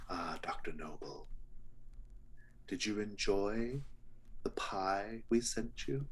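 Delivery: unhurried menace, drawing out the question; politely menacing villain from the shadows; sinister butcher. Acted. Butcher greeting Doctor Noble from the shadows, sinister and polite.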